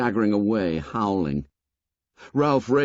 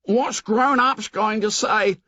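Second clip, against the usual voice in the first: high, slow anxious cry; lightly voiced fretful Piggy; 12 semitones higher than usual. A narrator's reading. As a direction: wail it in worry. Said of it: "cried Piggy again".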